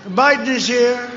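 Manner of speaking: booming mock announcement; mock announcer call. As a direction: call out Biden like an arena announcer. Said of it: "They say".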